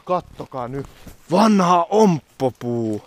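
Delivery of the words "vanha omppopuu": drawn out slowly, a bit louder and higher; mock-grand tour guide naming a tree; slow proud pronouncement. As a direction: announce the old apple tree grandly.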